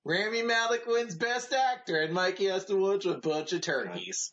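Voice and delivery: high, singsong taunting announcement; hammed-up teasing results announcement; gloating mock proclamation. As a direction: proclaim his defeat with relish, laughter creeping in. Mock proclamation of friend's lost bet.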